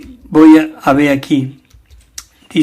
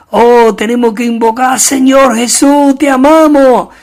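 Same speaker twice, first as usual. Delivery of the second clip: shouted praise; 6 semitones higher, 5 dB louder than usual. Pretend.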